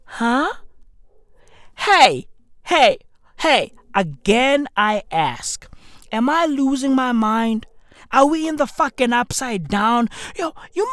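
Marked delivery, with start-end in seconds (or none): none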